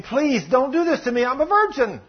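high-pitched acted plea; begging. Tamar to her stepbrother as he grabs her, begging. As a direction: plead urgently in her voice. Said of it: "And she begs him".

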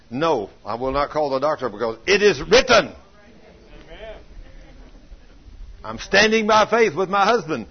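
firm refusal building to a loud proclamation; defiant declaration of faith. Retold or imitated. Acts wife defying the doubt.

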